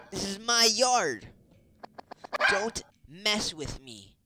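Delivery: high, loud, drawn-out shouting; hammed-up furious character yell; enraged game dog. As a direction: bellow like an outraged boss dog.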